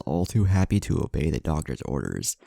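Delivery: usual speaking voice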